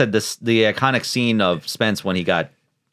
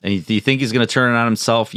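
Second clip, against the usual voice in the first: usual speaking voice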